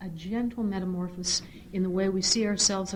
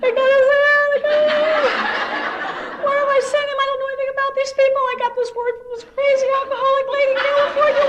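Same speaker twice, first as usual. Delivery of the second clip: much higher and louder tearful wail; crying; 10 dB louder than usual. Real, not acted. Mother reliving panic about son in rehab, tearful wail. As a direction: sob it out in laughing despair. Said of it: "Now I'm crying".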